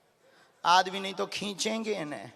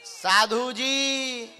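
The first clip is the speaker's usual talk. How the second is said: drawn-out call; 4 semitones higher, much slower than usual. Real, not acted. Calling someone from stage.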